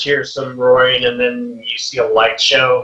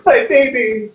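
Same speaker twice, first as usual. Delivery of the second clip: a much higher, joyful cry with a touch of tears; tearful joy; 14 semitones higher, 3 dB louder than usual. Acted. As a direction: burst out with teary delight. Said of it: "He's all crying a little bit".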